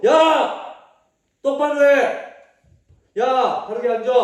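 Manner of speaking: loud, high barking commands; shouting leader. Retold or imitated. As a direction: yell at them to sit straight. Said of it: "막 이렇게 막 소리 지르면서". (큰 소리로 호통치듯)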